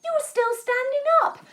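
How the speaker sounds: hammed-up amazement; amazed. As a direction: act astonished for the children. Mock amazement at kids.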